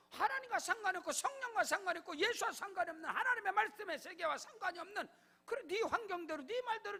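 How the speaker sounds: loud, high pulpit proclamation; full-force preaching. Acted. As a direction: decree it over the congregation. Preaching rebuke. (강단에서 외치는 설교)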